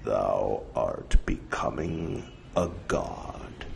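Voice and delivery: very low, slow, hushed pronouncement; deep, portentous oracle voice; ominous. Acted. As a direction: intone it darkly, word by word. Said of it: "in a most ominous tone".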